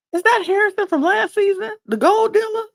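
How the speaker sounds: very high, loud imitation of startled recognition; mock excited squeal. [name] spots Harrison walking in, mock excited squeal. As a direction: squeal the question in hammed-up amazement.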